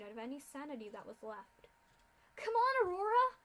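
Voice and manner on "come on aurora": high, loud desperate plea; pleading yell. Acted. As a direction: beg her to move, now. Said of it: "Scootaloo pleaded".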